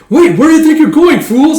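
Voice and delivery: very high, loud frantic yell; shrieking commander. Acted. Commander yelling at hesitant troops, very high shriek. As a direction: screech at the troops to attack.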